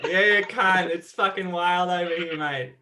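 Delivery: lightly put-on Australian accent, laughing in places; shitty Australian accent. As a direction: play a rough Aussie bloke in Australian accent. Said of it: "Do your Australian accent".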